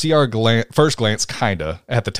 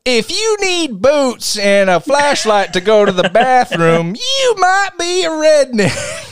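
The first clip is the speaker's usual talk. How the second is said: high, slow comic drawl that collapses into laughter; laughing comedian impression; Foxworthy impression; 5 dB louder, slower than usual. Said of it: "Jeff Foxworthy, who did".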